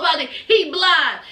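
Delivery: ordinary talk, nothing marked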